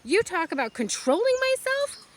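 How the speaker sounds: high, loud, outraged protest; a daughter shouting at her father over the axe; indignant yell. Acted. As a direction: argue for the pig's life at full voice. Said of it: "yelled Fern".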